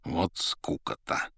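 slow bored grumble; grumbling. A narrator's reading. Рыжий ворчит на Маргариту, скучающе. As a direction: drag it out, utterly bored. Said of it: "проворчал Рыжий".

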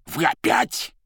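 высокий возмущённый возглас; взвиться от досады; возмущение. Acted. Азазелло возмущён, перебивает Маргариту. (возмущённо, высоким голосом)

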